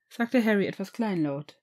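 plain reading voice

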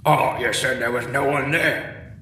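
snapping. Acted. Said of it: "one of the men snapped".

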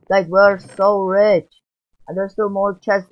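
high, slow, drawn-out cry of joy; excited shout. Real, not acted. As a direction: shout it out to the guys, thrilled. Gloating over full treasure chests.

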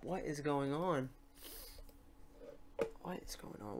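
ordinary delivery

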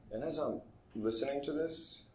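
ordinary talk, nothing marked

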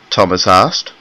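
ordinary delivery

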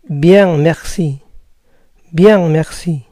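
Bien, merci with nasal "byan"; French bien merci, falling. Shown as a demonstration. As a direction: answer like a French speaker. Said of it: "Bien, gracias".

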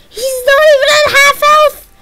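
high, loud panicked cry. Real, not acted. Gamer panics at the boss's health, high and loud. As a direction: voice jumps up in alarm. Boss fight going badly.

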